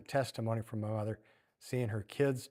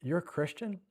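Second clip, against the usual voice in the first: surprised question; 4 semitones higher, 3 dB quieter than usual. Acted. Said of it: "all of a sudden you go like".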